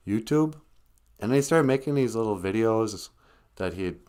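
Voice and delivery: usual speaking voice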